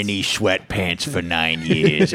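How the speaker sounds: slow, put-on New Yorker regular; aggrieved New Yorker character; New Yorker guy voice. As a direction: drag it out as an indignant New York regular. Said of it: "I realize I have one voice".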